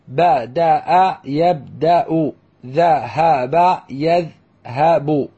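بدأ يبدأ slowly as ba-da-a, yab-da-u; teacher drilling بدأ يبدأ and ذهب يذهب, each syllable held. Shown as a demonstration. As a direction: sound out ذهب يذهب in even, separate beats. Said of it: "مثل".

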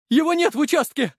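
very high, alarmed cry; panicked report. A narrator's reading. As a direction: report bad news in a panic. Panicked report of missing medic. (панический выкрик высоким голосом)

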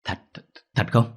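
startled, halting disbelief; panicked stammer. A narrator's reading. Mother hears the grisly news and stammers in alarm. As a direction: stammer the question, catching on the first word. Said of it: "Bà Lành nghe vậy thì hốt hoảng".

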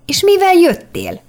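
amazed. A narrator's reading. Daniel asks how she came, high and amazed. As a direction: let the wonder lift the pitch. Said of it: "kérdezte ámulva Daniel".

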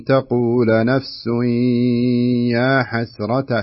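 تقول نفس held long with nasal ghunna; ghunna nasalization. Shown as a demonstration. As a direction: stretch يا حسرة slowly, nasal hum held. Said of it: "شوف، ما تقول".

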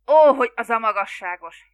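loud burst of frustration; exasperated oath. A narrator's reading. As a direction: swear loudly, at your limit. Exasperated oath at his mother. (hangos, bosszús kifakadás)

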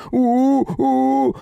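loud high muffled howl; gagged howl. A narrator's reading. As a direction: wail through a gag. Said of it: "Воришка снова взвыл".

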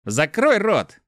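резко, со злостью; sharp snap. A narrator's reading. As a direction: bark it to silence him. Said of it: "отрезал Мефис".

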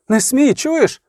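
high, mildly acted cry of protest; frightened cry. A narrator's reading. Frightened lover trying to stop the beating, frightened cry. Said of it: "Крик подіяв".

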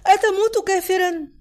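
crying out. A narrator's reading. Sabrin screams at suicidal boy, high narrated cry. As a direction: cry it out on a high sustained pitch. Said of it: "صرخت صابرين".

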